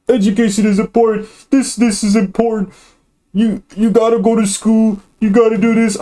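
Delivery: high mock-preachy voice; mocking naggers. Mimicking people who say education is important, mocking. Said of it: "I'm sorry if I'm mocking you".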